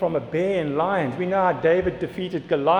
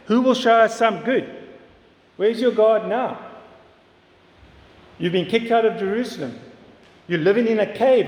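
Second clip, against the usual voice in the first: imitated jeering, higher and pressing; taunting enemies; 5 semitones higher, 3 dB louder than usual. Enemies mocking exiled David about his son, taunting. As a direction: hurl mocking accusations, voice raised in pitch. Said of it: "we see the enemies taunting him".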